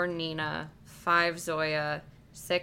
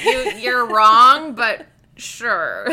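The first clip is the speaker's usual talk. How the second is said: mock indignant; 3 semitones higher, 15 dB louder, much slower than usual. Mock reply to imagined reader.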